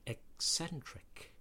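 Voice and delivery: "eccentric" split slowly as ek-SENtric; K S sound. Shown as a demonstration. Examples of double C words, slow K S sound. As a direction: separate the k and s in "eccentric". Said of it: "are pronounced with a K S".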